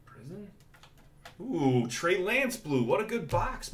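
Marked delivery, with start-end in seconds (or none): 1.4-3.7 slow, higher, delighted drawl over the card; drawn-out happy gasp at a good card; excited. Real, not acted. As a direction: savour the pull with real delight. Pulling a good card live.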